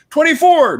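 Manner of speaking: very high, loud number call; yelled out. Acted. Newcomer tries telling a numbered joke, high acted yell. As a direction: holler it high like a punchline. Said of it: "someone will yell out a number".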